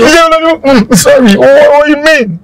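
high-pitched, defensive pleading; flustered protest. Acted. Flustered protest after being scolded.

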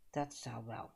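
stiffly. A narrator's reading. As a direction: close the topic coldly. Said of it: "zei mevrouw Duffeling stijfjes". (stijfjes, lager en zachter)

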